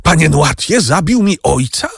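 high, stunned question; horrified disbelief. A narrator's reading. Franz confronts Noirtier, horrified disbelief. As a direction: refuse to believe it.

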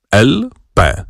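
ordinary delivery, nothing marked

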